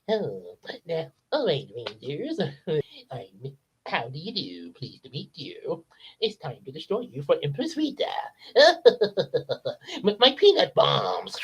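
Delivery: polite gentleman peanut, sinking to a whispered threat; Mister Peanut voice. Retold or imitated. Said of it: "the voice I've always imagined Mister Peanut having".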